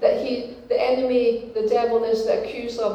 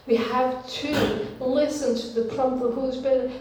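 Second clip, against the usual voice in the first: ordinary delivery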